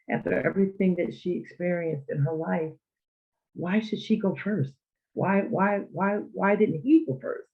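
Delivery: retold anger. Grieving child questions why mother went first, retold anger. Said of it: "I was very angry".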